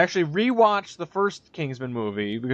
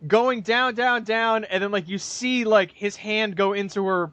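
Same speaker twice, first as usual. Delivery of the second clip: ordinary talk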